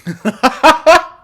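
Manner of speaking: a high, loud burst of laughter; sudden laugh. Real, not acted. Watching the streamer, bursts into a high, loud laugh. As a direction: cracks up at the clip without warning.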